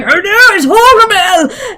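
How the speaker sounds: high-pitched squeaky villager cry; tiny townsperson in hammed-up alarm; mock panic. Scrambling townsfolk spotting Horgamel, mock panic.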